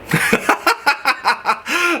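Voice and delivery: a burst of high-pitched laughter; high giggle. Real, not acted. Laughing at own story.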